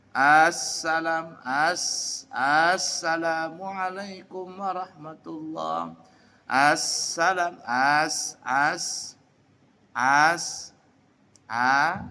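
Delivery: "Assalam" slow with a long stretched opening a; long hamzah. Shown as a demonstration. Teacher imitating the wrong long-hamzah reading. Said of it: "memanjangkan huruf hamzah".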